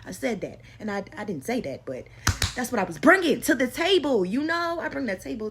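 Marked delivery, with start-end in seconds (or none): 2.6-5.5 emphatic boast. Real, not acted. Emphatic boast about herself.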